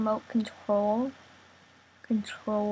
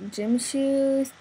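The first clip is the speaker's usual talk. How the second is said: ordinary talk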